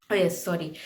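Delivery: ordinary talk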